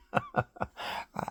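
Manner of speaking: high, breathless chuckling; laughter. Real, not acted. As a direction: break into a quick chuckle. Laughs at own made-up swear words.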